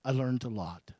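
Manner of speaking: ordinary talk, nothing marked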